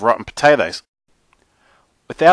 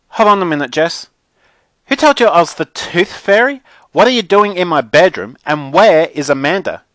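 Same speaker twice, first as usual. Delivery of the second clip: put-on high, loud, affronted girl; indignant Mackenzie; 6 semitones higher, 6 dB louder than usual. A narrator's reading. Mackenzie confronts the kid in her bedroom, indignant. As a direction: demand answers like an offended diva.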